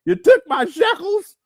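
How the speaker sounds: high, loud, slow mock woman's voice; mocking her. Mocking her shekels claim to the chat, slow, high and loud. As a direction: stretch the accusation out slowly, up high. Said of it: "She said".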